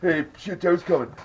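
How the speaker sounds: mocking rant. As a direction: scoff at their lame plan. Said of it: "He's like".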